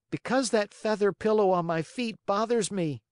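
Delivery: put-on high, fussy little boy; whiny child. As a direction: complain in a high fussy whine. Puppet making excuses.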